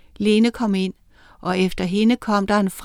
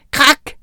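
crack sound; 6 semitones higher, 6 dB louder than usual. A narrator's reading. Wall makes cracking sound.

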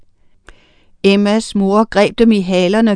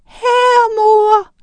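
very high, thin sing-out; high, held call from afar; calling child; 13 semitones higher, 5 dB louder than usual. A narrator's reading. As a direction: pipe up in a tiny high voice. Invisible child answers from the air.

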